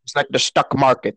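quoting in a put-on Indian Canadian accent. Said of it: "That was an Indian Canadian accent".

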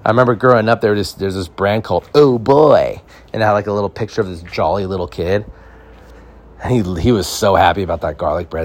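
usual speaking voice

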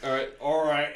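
normal talking voice

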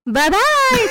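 very high singled-out farewell, imitated; mock sweet witch. Witch sending the baby downhill, mock sweet goodbye. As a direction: chirp it high in a put-on voice.

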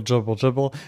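ordinary delivery

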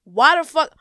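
usual speaking voice

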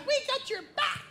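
put-on very high, soft kid voice; tiny kids whispering loyalty; squeaky hushed promise; hushed little kids. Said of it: "You guys got my back?".

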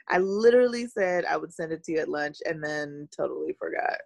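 ordinary delivery

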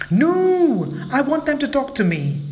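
high, pleading monkey voice; insist, close to tears; sad little monkey. Acted. Mabel begging grandma, high and sad. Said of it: "looked at his grandmother sadly".

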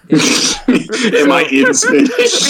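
high, drawn-out mimicry through laughter; laughing echo. Mocking a friend's silly question, high and laughing. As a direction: repeat it slowly while cracking up.

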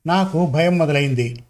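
ordinary delivery, nothing marked

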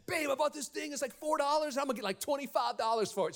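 high, eager rush of good news; excited bargain hunter. Retold or imitated. Husband phoning wife about a pawn shop find, acted excitement. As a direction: re-enact an excited call to your wife.

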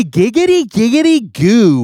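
high squealing "giggity, giggity, goo"; high mock "giggity goo"; Quagmire catchphrase. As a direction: do the cartoon lech bit. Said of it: "I say".

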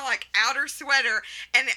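ordinary talk, nothing marked

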